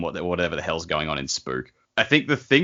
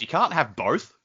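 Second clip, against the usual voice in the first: ordinary delivery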